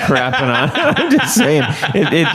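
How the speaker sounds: laughing through the words; protesting while giggling. Real, not acted. Teased, laughs while denying.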